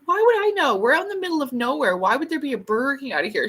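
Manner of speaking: put-on exasperated girl. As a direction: fire it back like it's obvious. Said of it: "she's like".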